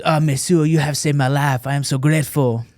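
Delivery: put-on French accent, gushing thanks; French accent impression. French reporter thanking the Marine who saved him, French accent. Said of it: "I could do an impression".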